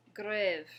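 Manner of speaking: "grève" loud, with French R and open eh; careful French pronunciation. Shown as a demonstration. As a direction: model "grève" firmly after the learner's turn. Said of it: "the next word we have is".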